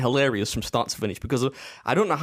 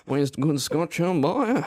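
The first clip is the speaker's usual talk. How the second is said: character impression; 6 semitones higher than usual. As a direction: play a hammed-up film character, voice swooping high. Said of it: "felt like he was in the room".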